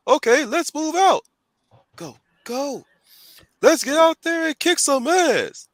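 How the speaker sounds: high cartoon henchman chatter; goon voice. Goons rallying each other to attack, high eager goon voice. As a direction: pump up the squad like an eager goon. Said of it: "I'm doing the goon voice".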